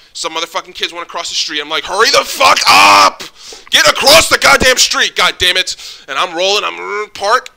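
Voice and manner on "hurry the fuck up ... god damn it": high, loud, drawn-out angry yelling; impatient yell. Retold or imitated. Yelling at kids crossing in front of his car, impatient bellow. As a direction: bellow at the dawdling kids to hurry.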